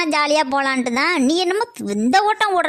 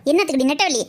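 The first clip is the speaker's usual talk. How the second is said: usual speaking voice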